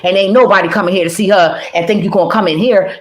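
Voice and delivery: ordinary talk, nothing marked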